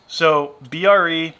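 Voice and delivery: usual speaking voice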